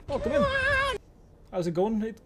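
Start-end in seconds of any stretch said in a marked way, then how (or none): 0.1-0.5 sudden very high squeaky-high welcome; high-pitched greeting. Real, not acted. Streamer greeting a new viewer, in a very high voice. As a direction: pop it out far above normal pitch.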